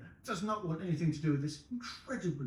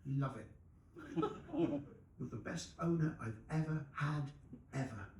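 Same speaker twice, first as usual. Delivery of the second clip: adoring dog; 10 dB quieter than usual. Acted. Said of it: "it's got two voices".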